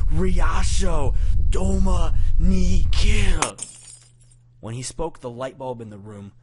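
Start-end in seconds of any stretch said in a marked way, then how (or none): 0.1-3.5 slow, high, full-force scream of rage; enraged shout. A narrator's reading. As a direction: scream each foreign word out in fury. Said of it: "he shouted them in a rage".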